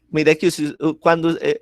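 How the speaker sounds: ordinary talk, nothing marked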